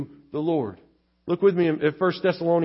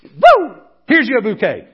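high, loud stage-magician flourish; mock conjurer presenting a trick; showy magician; 5 semitones higher, 8 dB louder than usual. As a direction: ham up a flashy illusionist's reveal. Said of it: "the illusionist goes".

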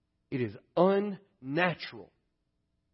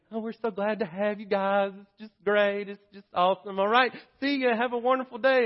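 mom's fake cheer; 8 semitones higher, 3 dB louder than usual. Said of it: "my mom would be".

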